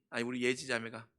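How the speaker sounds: ordinary talk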